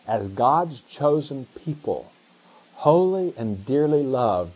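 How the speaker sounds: slow, weighty reading of Scripture; solemn, slowed text read. Shown as a demonstration. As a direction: linger on each word. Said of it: "reading the text slowly".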